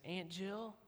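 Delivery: lightly voiced little girl, innocent; little niece. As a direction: niece piping up from the back seat, softly. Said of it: "sticks her head up ... and says".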